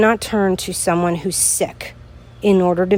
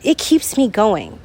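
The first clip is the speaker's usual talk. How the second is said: ordinary talk, nothing marked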